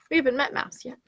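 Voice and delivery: ordinary delivery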